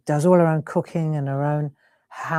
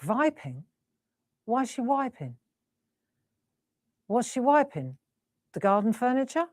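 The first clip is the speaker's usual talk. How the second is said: puzzled; 6 semitones higher, 6 dB quieter than usual. Retold or imitated. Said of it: "And I seriously thought".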